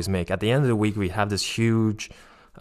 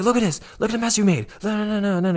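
imitating a furious drunk, voice way up; angry scolding; 12 semitones higher, faster than usual. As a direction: berate them for the mess. Drunk man scolding the mess.

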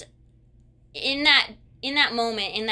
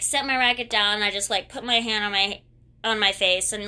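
ordinary talk

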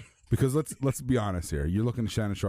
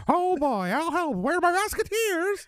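squeaky cartoon voice; slower than usual. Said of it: "cartoon voice bit".

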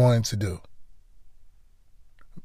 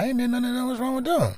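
mocking clueless person; 13 semitones higher, 3 dB quieter than usual. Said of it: "People like".

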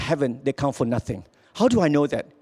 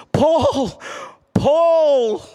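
high calling from afar, drawn out; 8 semitones higher, 4 dB louder than usual. Retold or imitated. God calling Paul from heaven, a high ringing call. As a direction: call his name up from heaven. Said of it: "calling out to me".

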